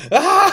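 very high, loud laughing cry; laughing shriek. Retold or imitated. Storyteller at the back bursts out crying with laughter, loud shriek. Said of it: "and I'm like".

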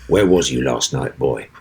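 low, gruff stage menace; stage character line. Acted. Actor repeating his line to the fainted co-star, low gruff menace. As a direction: drop low and lean in with threat. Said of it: "and I went".

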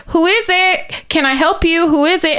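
high-pitched voices calling from behind a door; neighbors calling out. Retold or imitated. Two neighbors answer a late doorbell, imitated calling out. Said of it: "I hear two different people like".

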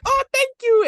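put-on high-pitched grateful child; little girl. Little girl thanking Superman for her cat, little-girl voice. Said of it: "the little girl's like".

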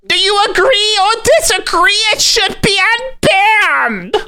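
very high squeaky cartoon voice. Host introducing the next speaker in a squeaky high voice. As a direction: pitch way up like a squeaky toy.

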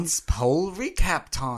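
slow, drawn-out British voiceover; measured British-accent impression; Melissa Vaughn. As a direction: echo a sedate British announcer, stretching the words. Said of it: "Melissa Vaughn is much more sedated".

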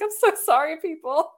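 tearful apology. Retold or imitated. Retold apology to restaurant diners.

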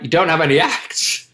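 stunned, laughing echo of the question; half-laughing disbelief with a rising question; incredulous laugh. Retold or imitated. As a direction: repeat it back in disbelief, laughing through it. Incredulous echo of manager's words.

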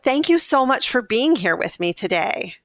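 ordinary talk

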